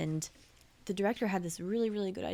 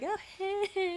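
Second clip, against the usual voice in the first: put-on high, bright-eyed "hey, hey" as giddy girls; 13 semitones higher than usual. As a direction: coo it like smitten girls spotting him. Girls greeting a rare straight guy.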